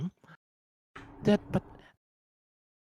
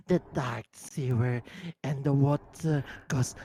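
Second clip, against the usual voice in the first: usual speaking voice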